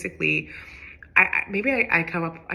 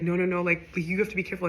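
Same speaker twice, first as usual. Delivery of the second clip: ordinary talk, nothing marked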